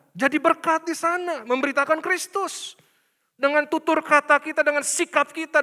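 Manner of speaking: loud, high sermon push; preaching at full force. Acted. Pastor warns workers against serving money, preaching loudly. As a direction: drive the point home loudly.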